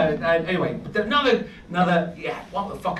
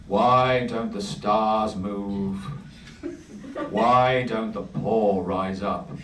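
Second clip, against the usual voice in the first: poet voice; 7 semitones lower, slower than usual. Acted. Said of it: "I have to put on a poet voice for this".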